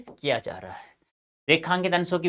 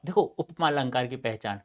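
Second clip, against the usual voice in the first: ordinary talk, nothing marked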